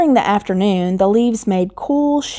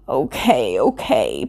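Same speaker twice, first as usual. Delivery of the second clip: low, drowsy grumble; tired voice; 6 semitones lower than usual. Acted. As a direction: sound half asleep and low. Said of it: "in a tired voice".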